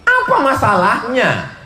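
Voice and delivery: high, laughing whine about his rival; whiny jilted man. Acted. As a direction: pitch it way up, half singing the complaint. (pemuda patah hati merengek dengan suara tinggi)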